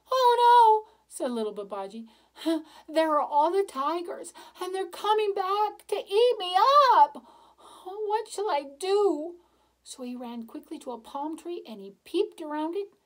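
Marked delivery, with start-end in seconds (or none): 0.1-0.9 high, loud panicked cry; boy realising the tigers are back; frightened. Acted. 2.9-9.4 high, loud, panicky; boy panicking as tigers return; frightened. Acted.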